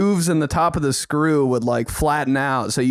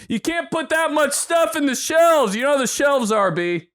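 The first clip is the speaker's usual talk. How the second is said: mock dad scold; 11 semitones higher than usual. Imitating angry dad blaming him for the fridge mess. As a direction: scold like a fed-up father. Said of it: "And it was probably somehow my".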